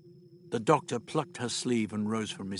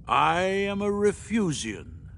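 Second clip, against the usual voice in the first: slow, raised, grand announcement; unseen Refusian; 7 semitones higher, slower than usual. Acted. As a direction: proclaim it slowly, stretching the words. Unseen being announces itself.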